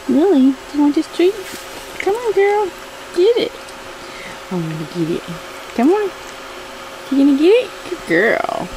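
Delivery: high urgent coax to the dog; careful, careful, high and tense; anxious dog coaxing. Real, not acted. Offering dog treat.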